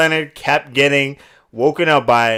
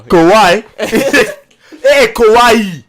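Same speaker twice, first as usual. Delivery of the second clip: put-on loud, higher African accent with laughs, slow at the end; 7 semitones higher, 7 dB louder, much slower than usual. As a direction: call Kawhi in a hammed-up African accent. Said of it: "a good African accent".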